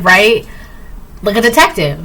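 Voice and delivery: usual speaking voice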